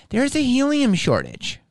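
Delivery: put-on high, lilting Chicano clerk accent. Clerk explaining the helium shortage, high Chicano accent. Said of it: "I'm gonna do an accent".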